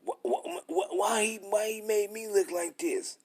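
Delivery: squeaky high stammering mock question; mocking whiner. Preacher mocking the wicked asking why, squeaky and high. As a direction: squeak out a stuttering complaint. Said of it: "And they can't say".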